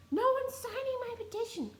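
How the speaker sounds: high mocking whine of a ranting woman; whiny petition lady. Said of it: "She goes".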